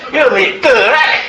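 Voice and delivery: high, loud mock Glaswegian with a laugh through it; laughing through a badly faked Scottish accent; Glasgow accent, laughing. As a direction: redo the bad Glasgow accent while cracking up. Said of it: "in an accent which I'll do, but it's terrible".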